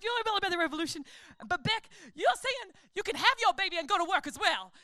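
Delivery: mock preaching, fast; imitating preacher. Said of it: "then she turned into someone else".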